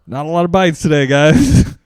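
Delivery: high, loud show for the office; mock report to coworkers. Salesman reporting back to coworkers after napping, mock report. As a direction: pitch it up like an excuse announced to the room.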